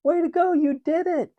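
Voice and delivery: very high, loud cheering; celebrating. Retold or imitated. Coach praising one soda-free dinner, squealing with joy. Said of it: "I'm like".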